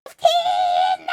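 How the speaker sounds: usual speaking voice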